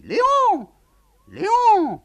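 high, loud call from upstairs. Acted. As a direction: shout the name far up the house. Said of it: "la voix crie".

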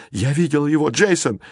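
put-on high, urgent girl's cry; alarmed exclamation. A narrator's reading. Girl points into darkness, crying out high to boy. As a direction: exclaim it in alarm to your friend. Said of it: "воскликнула девочка".

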